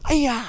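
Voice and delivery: piercing high kiai yell, loud; kiai shout. Shown as a demonstration. Instructor showing the final move shouts a loud kiai. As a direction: finish with a sharp battle cry. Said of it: "Last move is fast and strong".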